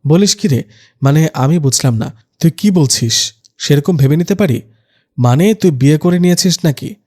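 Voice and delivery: plain reading voice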